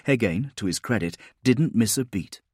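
usual speaking voice

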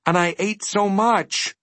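high, slow plaintive complaint; complaining. A narrator's reading. Milo with an empty stomach, complaining. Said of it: "complained Milo".

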